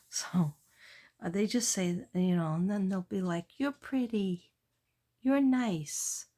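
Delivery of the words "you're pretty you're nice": put-on a light child voice. Kids' compliments, child voice.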